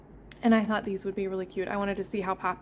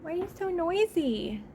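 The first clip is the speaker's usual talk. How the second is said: pet talk; 9 semitones higher than usual. Real, not acted. Talking to meowing cat.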